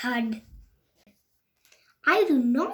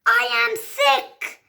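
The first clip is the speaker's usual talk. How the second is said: high loud slow I am sick; delighted; 10 semitones higher, 5 dB louder, slower than usual. Acted. Gerald learning he is only sick, high and gleeful. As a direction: savour it with glee.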